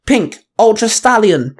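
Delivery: high, loud battle call. A narrator's reading. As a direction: shout the morph call up high. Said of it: "the Pink Ultra Ranger called out".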